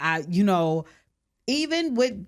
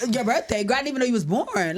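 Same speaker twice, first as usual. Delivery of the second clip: high, fast laughing mock reply; mock oblivious friend; 7 semitones higher, faster than usual. Friend imitating herself brushing off the birthday, fast and laughing. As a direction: toss it off like you forgot the birthday. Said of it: "I'll be like".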